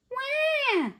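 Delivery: high-pitched imitated baby wail; baby's yell. A narrator's reading. Baby ghost when the milk is gone, high wail. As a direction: squeal like an unhappy baby ghost. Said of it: "yelled the baby ghost".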